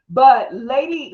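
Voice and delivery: ordinary talk, nothing marked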